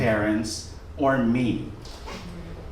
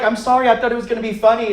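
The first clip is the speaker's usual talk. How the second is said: rushed apology; 7 semitones higher, faster than usual. Retold or imitated. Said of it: "And I was like".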